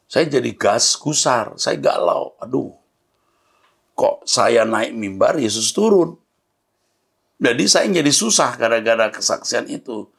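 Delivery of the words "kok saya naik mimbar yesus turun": ordinary talk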